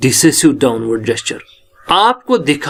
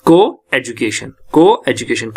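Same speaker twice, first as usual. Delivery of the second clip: co-education with "co" set apart and raised; compound word demo; much slower than usual. Shown as a demonstration. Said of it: "compound word है".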